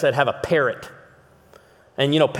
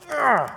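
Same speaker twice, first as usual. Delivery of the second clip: disgusted groan; 10 semitones higher than usual. Retold or imitated. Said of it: "they were just like".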